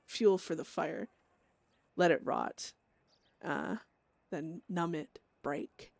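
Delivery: grim, brooding inner monologue; dramatic journal reading. Acted. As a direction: read it like a haunted diary entry.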